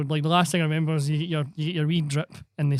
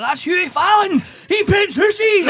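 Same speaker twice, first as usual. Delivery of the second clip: high, loud, giddy shout of a man off his head; drugged shouting; 6 dB louder, slower than usual. Retold or imitated. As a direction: yell it out like you're high on ketamine. Drugged patient shouting in hospital.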